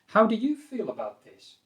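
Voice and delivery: "How do you feel about this?" pitched high on "How do you", then falling. Shown as a demonstration. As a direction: ask with interest, lifting "do you" high. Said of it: "you probably need to raise the intonation".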